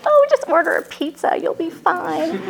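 high, airy mock of a dismissive friend; breezy brush-off. Friend brushing off her desperate call, breezy mock voice. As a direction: imitate a friend blithely waving it away.

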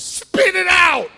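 huge, high, slow bellow; desperate yell. Acted. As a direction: roar at the fly to give the beer back. Alcoholic yells at fly in beer.

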